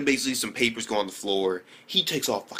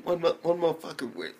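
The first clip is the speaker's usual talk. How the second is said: ordinary talk, nothing marked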